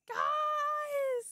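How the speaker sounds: high, drawn-out squeal of amazement; overwhelmed cry. Real, not acted. Overwhelmed by donation total.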